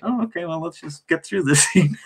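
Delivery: brushing it off, lightly voiced; resigned. Actor retelling bleeding on set, mild resigned shrug. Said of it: "And I was like".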